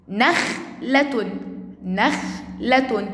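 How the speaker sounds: نخلة said slowly with a halt on the kh; stopping on sukun. Shown as a demonstration. Teacher showing where she stopped, slow split reading. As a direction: break نخلة after the kh, then finish. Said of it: "أنا هنا وقفت".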